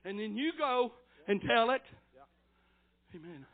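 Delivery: ordinary delivery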